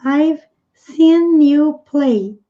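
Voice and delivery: slow, careful English line; deliberate teacher pace; slow model reading. Shown as a demonstration. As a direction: read it out slowly for learners. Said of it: "teacher models slowly".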